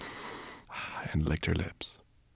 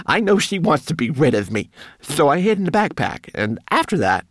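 high, loud, wronged little creature; aggrieved homunculus; 11 semitones higher, 8 dB louder than usual. A narrator's reading. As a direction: pour out the complaint in a small high voice. Homunculus accuses the brownie.

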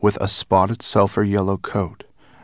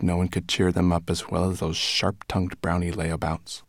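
ordinary delivery, nothing marked

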